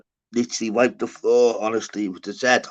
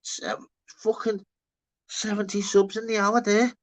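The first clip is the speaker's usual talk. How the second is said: high imitated wondering; 9 semitones higher, 6 dB quieter than usual. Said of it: "you're thinking".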